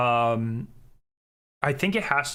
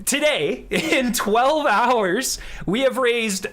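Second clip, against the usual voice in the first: higher, louder, amazed announcement; laughing with joy at the total; excited donation thanks; 7 semitones higher, 3 dB louder than usual. Real, not acted.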